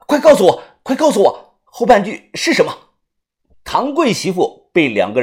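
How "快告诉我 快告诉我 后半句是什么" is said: loud desperate demand; urgent shouting. Acted. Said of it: "着急的大喊".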